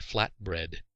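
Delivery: plain reading voice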